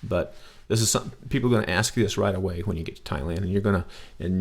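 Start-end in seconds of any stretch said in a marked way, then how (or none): none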